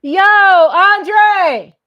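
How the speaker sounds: high, loud call across the house. Shown as a demonstration. Wife calling to husband in the back room, a loud call. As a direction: yell his name to the next room. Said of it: "I'll s-, just go".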